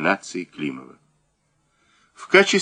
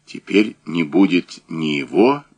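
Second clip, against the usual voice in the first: plain reading voice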